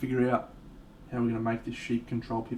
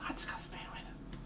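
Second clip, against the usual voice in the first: "let's go to food with" barely breathed, much softer and fading; faint whisper; 16 dB quieter than usual. Pretend.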